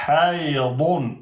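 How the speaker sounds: ordinary delivery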